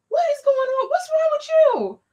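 husband seeing his wife sobbing in the car, high and alarmed. Retold or imitated. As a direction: ask in shock what happened. Husband alarmed at her crying.

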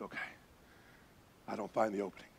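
low, very soft admission; hushed reply. Retold or imitated. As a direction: answer under your breath in the dark. Speaker answers guide in silent dark.

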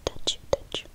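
usual speaking voice

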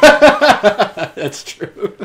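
loud, high laughing agreement; real laughter. Real, not acted. Friend amused by the Hal comparison, laughing loudly. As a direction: agree through a burst of laughter.

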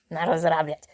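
ordinary delivery

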